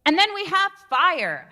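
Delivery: high, loud projecting voice; loud energetic demo; fire voice. Shown as a demonstration. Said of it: "fire is what I use to project".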